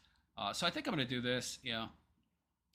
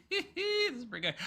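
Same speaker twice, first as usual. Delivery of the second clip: breaking into real laughter, much higher; laughing. Real, not acted. Streamer cracks up at the clip, laughing. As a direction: laugh it out, high and giddy.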